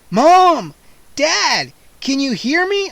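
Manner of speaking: loud high shout through the door. A narrator's reading. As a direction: pound and shout for parents. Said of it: "I shouted".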